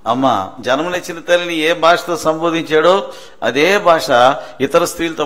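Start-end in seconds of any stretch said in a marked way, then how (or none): 0.1-0.5 lightly voiced low, gentle address; Jesus. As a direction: say it low, with some tenderness. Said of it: "ఏమని మాట్లాడేవాడు?". (కొంచెం లోతైన, మృదువైన పిలుపు)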